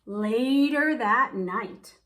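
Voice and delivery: plain reading voice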